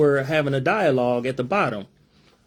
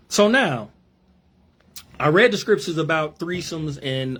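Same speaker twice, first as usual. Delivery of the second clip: ordinary delivery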